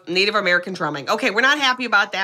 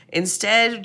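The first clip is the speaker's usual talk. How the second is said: ordinary talk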